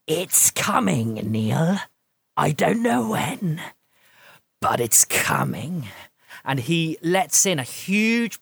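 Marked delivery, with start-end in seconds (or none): 0.1-5.9 rasping, slow, loud creature warning; low rasping voice. Acted. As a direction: rasp out a cold omen. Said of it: "as he speaks in a low, rasping voice".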